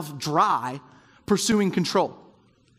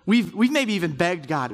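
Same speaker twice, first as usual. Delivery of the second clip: ordinary talk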